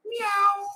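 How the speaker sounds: high mewing meow, like a stray cat at the door. Retold or imitated. Preacher imitating a stray cat meowing at the door. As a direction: mew it high and thin like an alley cat.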